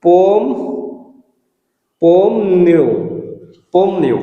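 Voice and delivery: পমনিউ stressed on পম; teacher repeating পমনিউ for students; model pronunciation. Shown as a demonstration. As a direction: say পমনিউ clearly, syllable by syllable. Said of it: "এখানে আপনি পমনিউ দিতে পারেন".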